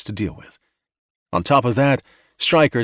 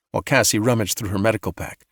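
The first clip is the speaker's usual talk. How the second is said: ordinary delivery, nothing marked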